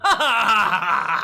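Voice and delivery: big, loud burst of laughter. Pretend. Joking about being British scum, loud laugh. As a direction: laugh out loud, hard and high.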